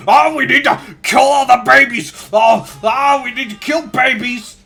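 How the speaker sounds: high, loud, frantic screaming; frothing yell. Acted. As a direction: scream it like a raving zealot. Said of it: "Just frothing at the mouth".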